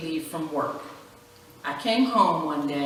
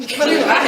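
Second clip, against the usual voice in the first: burst of laughter; 9 semitones higher, 8 dB louder than usual. Real, not acted. Laughing at herself.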